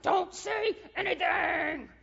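slow, drawn-out shout, word by word; hammed-up roar of exasperation, stretched long; mock divine bellow. God finally out of patience with the speaker, slow bellow. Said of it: "He's like".

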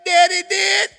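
put-on high, tearful little-kid voice; whimpering toddler. Preacher plays grandson answering mom, whimpering toddler. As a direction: imitate a small child owning up through tears.

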